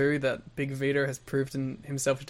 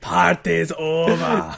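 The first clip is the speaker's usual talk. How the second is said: slow, drawn-out mock Scottish accent; mock Scottish, stretched slowly; 6 dB louder, much slower than usual. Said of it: "veered more to Scottish".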